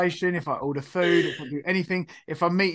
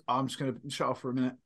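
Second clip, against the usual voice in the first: quick, low, softer aside; brain talking; 5 semitones lower, 8 dB quieter, faster than usual. Retold or imitated. Brain tuning out during introductions, offhand inner voice. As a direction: toss it off as a brain switching off. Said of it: "and your brain goes".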